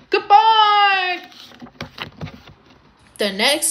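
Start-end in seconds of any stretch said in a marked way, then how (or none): 0.1-1.2 loud, high, held goodbye; breezy shouted farewell; calling out. A narrator's reading. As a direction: sing it out over a distance. Bertie calls out while leaving.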